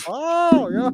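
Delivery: mock impressed. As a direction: ham up an impressed gasp. Voicing the scientists' impressed reaction.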